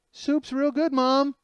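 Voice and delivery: put-on high, sing-out little-kid voice; placating little brother. Little brother trying to make mom happy, eager high voice. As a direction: pipe up high to please mom.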